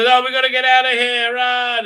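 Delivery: loud, high mock panic yell; hammed-up cry to run; a panicked shout to flee, played for laughs. Panicked escape in mocking recap.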